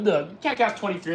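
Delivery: usual speaking voice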